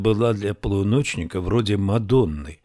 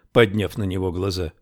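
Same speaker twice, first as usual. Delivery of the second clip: ordinary delivery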